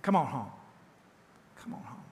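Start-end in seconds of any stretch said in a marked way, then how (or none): 1.6-2.0 much softer, almost breathed; soft echo. Shown as a demonstration. Pastor repeating the call to his flock as a hushed echo. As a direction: let the words fade out like an echo. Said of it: "echo of the line before".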